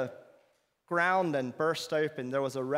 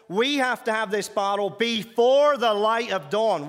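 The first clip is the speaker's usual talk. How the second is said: higher, louder menacing demand; threatening demand; 6 semitones higher, 7 dB louder than usual. Retold or imitated. Guard demanding the bottle before dawn. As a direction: lean in and threaten.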